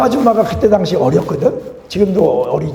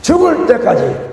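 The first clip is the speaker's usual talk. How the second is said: emphatic proclamation; 10 semitones higher, 4 dB louder, slower than usual. Acted. Preacher's emphatic answer.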